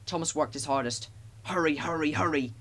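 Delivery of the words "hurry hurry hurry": breath-driven, urgent puffs; puffing. A narrator's reading. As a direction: chug the words out with effort. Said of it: "he puffed".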